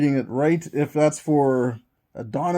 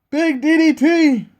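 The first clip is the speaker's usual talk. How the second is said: Big D D T called out higher and slower, punched; hyped echo; 11 semitones higher, 5 dB louder, slower than usual. Acted. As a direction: shout the move name like a hyped announcer. Repeats the move for hype.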